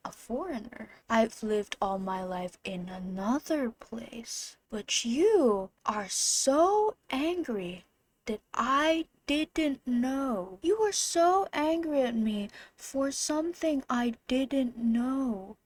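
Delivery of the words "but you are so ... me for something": slow indignant. Real, not acted. Slow indignant address to teacher.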